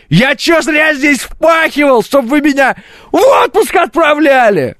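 high, slow, furious shouting; angry rant. Retold or imitated. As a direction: yell it like a furious worker. Furious rant against vacation.

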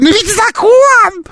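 high, slow squeaky parroting; squeaky mocking voice. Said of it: "повторять фразы оппонента своего писклявым голосом".

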